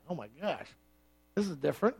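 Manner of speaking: very soft, low mock murmur; hushed newcomer. First-time visitor reacting to the service, hushed. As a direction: imitate a visitor muttering under the breath.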